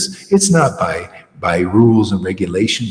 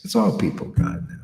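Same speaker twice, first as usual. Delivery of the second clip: whisper; 3 semitones lower, 8 dB quieter, faster than usual. Real, not acted. Scornful whispered aside.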